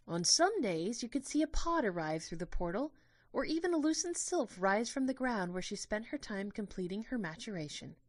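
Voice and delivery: a hint of a cheerful tour guide; gentle tour guide. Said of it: "a gentle voice".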